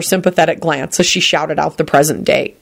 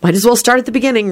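fast, higher musing; quick thought; 5 semitones higher, faster than usual. Acted. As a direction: rattle the thought off. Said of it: "Rosie thought".

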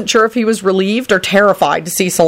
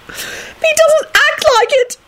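very high, drawn-out tearful wail; squeaky acted sobbing; blubbering; much slower than usual. A narrator's reading. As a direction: blubber it like a heartbroken girl. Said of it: "Rosie blubbered".

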